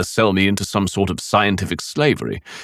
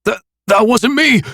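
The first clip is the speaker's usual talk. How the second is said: high loud stammered denial; yelled denial; 5 dB louder than usual. A narrator's reading. Nick denying guilt, yelling. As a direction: shout it, refusing the blame. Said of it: "he yelled, his voice heavy with denial".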